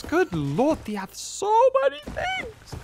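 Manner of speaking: squeaky high disbelief; astonished. Real, not acted. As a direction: shriek it in amazement. Shocked at enemy gear.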